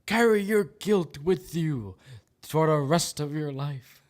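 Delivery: high, loud, slow sermon voice; hammed-up doom-laden decree; mock preacher. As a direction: preach the guilt in a mock grave tone. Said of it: "the left says no".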